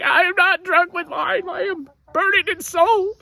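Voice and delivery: high, slow pleading woman's voice; high and drawn out, pleading with the priest; mother pleading she is not drunk, high and slow; Hannah's plea. Retold or imitated. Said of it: "And she's like".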